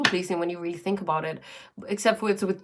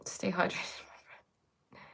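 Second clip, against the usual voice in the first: whispering; 9 dB quieter, slower than usual. Real, not acted. Aside while drinking on stream.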